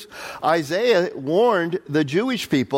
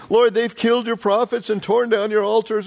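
a touch of a high plaintive complaint; mildly whiny Elijah; 6 semitones higher than usual. Retold or imitated. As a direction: lightly play Elijah's lament. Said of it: "appealed to God against Israel".

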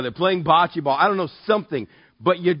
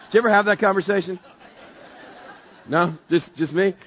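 ordinary talk

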